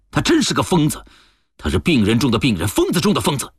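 worked up, high-pitched outburst; outraged denunciation. Acted. Man realizing who schemed against them, outraged denunciation.

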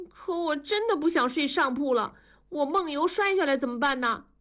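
put-on high, plaintive little girl; pleading child. A narrator's reading. (小女孩高声委屈地央求)

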